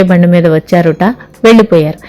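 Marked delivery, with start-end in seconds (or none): none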